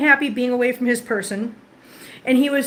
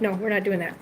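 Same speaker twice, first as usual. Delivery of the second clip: low, softer no to the cat; low firm scolding; 4 semitones lower, 5 dB quieter, faster than usual. Real, not acted. Telling the cat off for the closet, low and firm. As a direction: calmly shut down the cat's plan.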